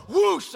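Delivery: loud whoosh of igniting gasoline; imitated fire whoosh. Boy lights the match on gasoline, imitated whoosh. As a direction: blast out a whoosh like flames catching.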